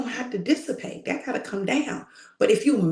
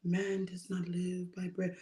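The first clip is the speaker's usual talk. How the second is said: much softer, small and drained; a feeble, half-hearted reading; weak, timid; 3 semitones lower, 12 dB quieter than usual. Shown as a demonstration. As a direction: say it with no strength at all. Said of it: "I know Jesus wasn't saying".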